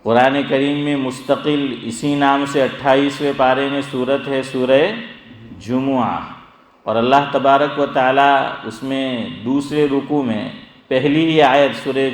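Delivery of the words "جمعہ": "جمعہ" as ju-mu-aa, u after the meem; three clear syllables in "جمعہ", ju-mu-aa; correct Arabic pronunciation. Shown as a demonstration. Preacher naming the Quran chapter, careful Arabic pronunciation. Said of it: "تو یہ ہے جمعہ".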